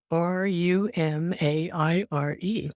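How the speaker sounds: each letter spelled out slowly, R U M A I R E; slow spelling. Retold or imitated. New grad writing the drug name down, slow spelled letters.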